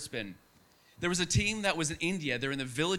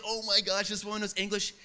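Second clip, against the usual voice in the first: higher-pitched retold excitement; psyched; 4 semitones higher than usual. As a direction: act mildly freaked out with delight. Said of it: "totally psyched, freaking out".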